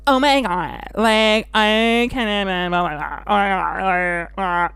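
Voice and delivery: a hint of a breathless socialite gushing; Kim Kardashian impression. Mocking club gays who talk like Kim Kardashian, lightly imitated Kardashian gush. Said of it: "talk like Kim Kardashian".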